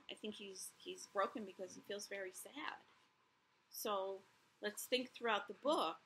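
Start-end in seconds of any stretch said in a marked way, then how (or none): none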